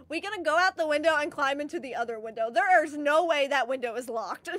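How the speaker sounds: ordinary talk, nothing marked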